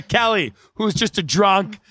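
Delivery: ordinary delivery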